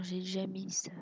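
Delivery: whispered aside to herself. Real, not acted. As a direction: drop to a whisper. Muttering to herself while decorating.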